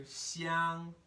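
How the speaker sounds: Xiang held high and level; high first tone. Shown as a demonstration. Teacher showing first tone high in the register, high level tone. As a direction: sustain Xiang on one high pitch. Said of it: "this first tone is high in my register".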